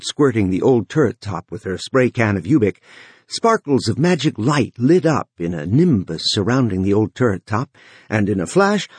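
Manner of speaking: ordinary delivery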